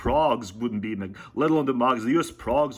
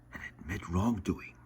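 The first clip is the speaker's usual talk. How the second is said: whispered aside; 4 semitones lower, 9 dB quieter than usual. Real, not acted. Aside after the UK point.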